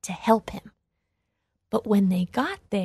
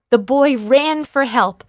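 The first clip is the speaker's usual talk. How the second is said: narration pushed higher and louder in alarm; fright; 4 semitones higher, 6 dB louder than usual. A narrator's reading. Narrator telling of the boy's fright, alarmed. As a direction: read it in a rush of fear. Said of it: "In a great fright".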